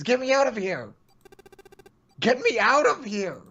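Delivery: desperate pleading. Acted. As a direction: beg them to open the door. Ninth man begging to be freed.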